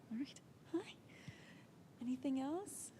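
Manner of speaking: ordinary talk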